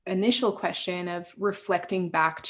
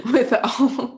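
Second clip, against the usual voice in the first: real laugh through the words, higher and louder; 6 semitones higher, 5 dB louder than usual. Real, not acted. Guest moved about coaching women, laughing as she talks. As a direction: let the laugh push the words up and out.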